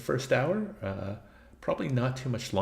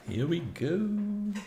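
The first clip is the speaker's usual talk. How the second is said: "Here we go" drawn out high and slow, rising to "go"; sing-song here we go; 10 semitones higher, 3 dB quieter, much slower than usual. Acted. Game starts, sung-out announcement.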